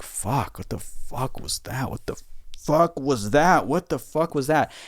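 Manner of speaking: shaken disbelief. Retold or imitated. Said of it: "wrapped in the towel being like".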